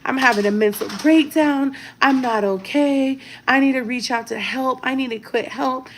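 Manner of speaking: imitated tearful breakdown, pitched high; mock crying. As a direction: wail it in a high, put-on crying voice. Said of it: "she's crying".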